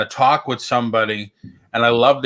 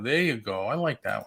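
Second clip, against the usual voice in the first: usual speaking voice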